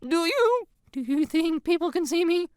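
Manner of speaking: put-on high, strained anxious girl voice. A narrator's reading. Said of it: "anxiously running up behind me".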